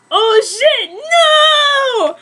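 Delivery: high, loud panicked shout; shocked cry. Acted. Character cries out as he turns into a bat, shocked shout. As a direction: yelp it out in sudden alarm.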